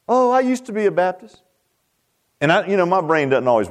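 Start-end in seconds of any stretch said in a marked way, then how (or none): 0.1-1.4 higher-pitched voice for a woman; a hint of a lady's higher voice; imitated lady. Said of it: "the lady says to me".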